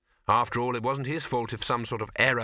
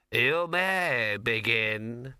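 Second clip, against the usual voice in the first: very slow solemn proctor; exam proctor; much slower than usual. Acted. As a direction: announce the start gravely. Wizard on dais.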